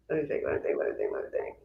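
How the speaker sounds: low, trailing-off mutter; thinking aloud, fading to a whisper; muttering to herself. Real, not acted. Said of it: "let me think of something".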